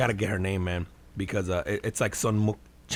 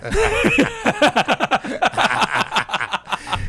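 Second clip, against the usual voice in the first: high loud burst of laughter; 5 dB louder than usual. Real, not acted. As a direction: crack up loudly. Big laugh.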